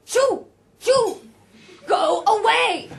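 high, very loud bursts; shouted dismissal, last words drawn out; shooing shout. A narrator's reading. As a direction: shoo him off at full volume. Roger shoos the boy away.

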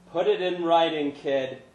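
gruff dismissive tone; Larry's brush-off. A narrator's reading. Larry brushing off the kid outside the bar. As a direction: wave the kid away.

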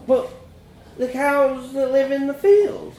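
put-on high, slow child's question; disbelieving child. A narrator's reading. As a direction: plead for clarification like a small boy.

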